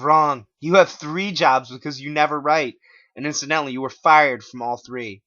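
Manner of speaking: smug Clyde. Acted. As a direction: correct him smugly. Clyde corrects him.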